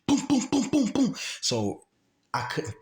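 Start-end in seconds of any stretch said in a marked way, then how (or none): 0.1-1.1 high, punched gunfire imitation; imitated gunshots. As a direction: mimic rapid shots with your voice. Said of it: "He started shooting".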